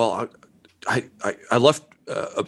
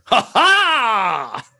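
happy shout; 9 semitones higher than usual. Acted. A gleeful ha ha shout heard from outside.

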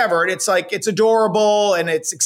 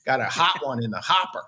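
low mock voice; 11 semitones lower, 6 dB quieter than usual. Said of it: "Don't get me started".

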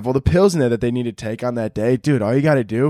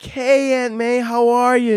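fake friendly greeting; 10 semitones higher, slower than usual. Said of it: "you're like".

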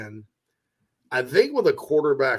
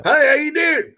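put-on South Carolina accent, pitched high and loud; hammed-up South Carolina greeting; Upstate South Carolina drawl; 14 semitones higher, 8 dB louder than usual. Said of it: "Sometimes my accent comes through".

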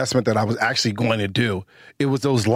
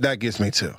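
ordinary talk, nothing marked

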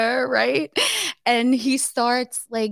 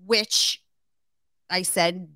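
ordinary talk, nothing marked

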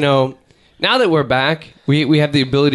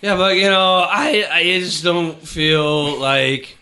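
put-on drunk earnest friend; 3 semitones higher, slower than usual. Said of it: "this is more how the conversation goes".

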